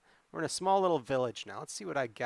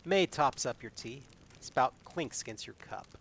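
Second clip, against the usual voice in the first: story narration; 3 semitones higher than usual. A narrator's reading. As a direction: read the adventure like a tale to the audience. Reads gamebook narration with May's lines.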